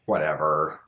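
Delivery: dismissive. Acted. Dismisses own question after sigh.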